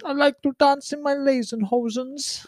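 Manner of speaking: put-on high, slow comic Dutch accent; hammed-up Dutch caricature; mock Dutch. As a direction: silly fake Dutch, drawn out high. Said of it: "give me an example of Dutch".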